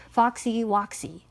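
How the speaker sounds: ordinary delivery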